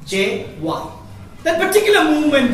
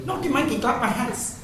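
put-on Indian accent; 8 dB quieter than usual. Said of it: "In an Indian accent".

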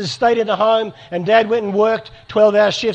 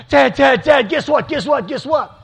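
higher, louder, eager chatter; eager daughter; 6 semitones higher, 3 dB louder than usual. Retold or imitated. Daughter greeting her dad at the door, bursting with eager news. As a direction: bounce with news you can't hold in.

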